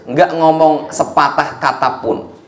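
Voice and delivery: normal talking voice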